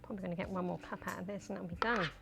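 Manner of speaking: ordinary talk, nothing marked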